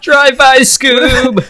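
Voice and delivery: high, loud cartoon voice calling out; hammed-up Shaggy impression. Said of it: "That's Shaggy".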